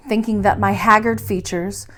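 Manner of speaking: ordinary delivery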